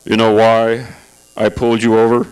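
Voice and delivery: smug patrolman question; traffic cop voice. Retold or imitated. Traffic cop swaggering up to the car, low smug voice. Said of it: "They come swaggering up to the car".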